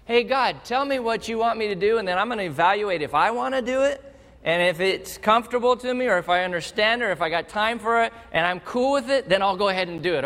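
breezy, fast, offhand prayer; self-mocking preacher imitating his lazy prayers, breezy; casual bargaining prayer. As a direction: haggle with God like a busy man. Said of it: "Let me tell you how I often pray".

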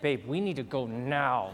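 urgent but hushed, don't make a scene; low firm second ask; firm insistence. Retold or imitated. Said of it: "I go".

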